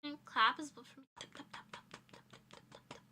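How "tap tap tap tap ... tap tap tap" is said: soft breathless whisper; whispered cat voice. Pretend. Voicing the cat to chat, pretend whisper.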